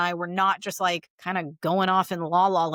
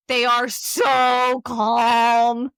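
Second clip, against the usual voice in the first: calm therapist voice; 4 semitones higher, 8 dB louder, much slower than usual. Shown as a demonstration. Mimicking therapists' cadence, very slow calm. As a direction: stretch each word like a soothing therapist. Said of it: "His tone of voice".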